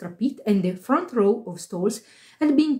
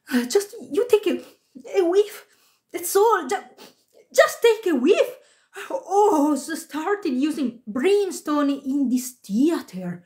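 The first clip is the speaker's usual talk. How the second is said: high-pitched agitated worker; indignant stagehand's mate; 6 semitones higher than usual. A narrator's reading. Stagehand's mate reports brimstone smell, high agitated voice.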